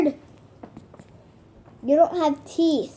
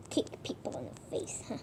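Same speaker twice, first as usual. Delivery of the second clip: breathy whisper, unvoiced; whispered aside; 5 semitones lower, 18 dB quieter, faster than usual. Real, not acted. Whispering a side remark about the pet. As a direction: lean in and whisper it.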